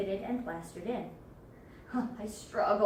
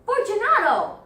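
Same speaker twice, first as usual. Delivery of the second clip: high, full shouted call; higher louder call; 9 semitones higher, 11 dB louder than usual. Acted. Montresor calling again after silence, shouting high. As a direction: shout up high, demanding an answer. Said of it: "I called again".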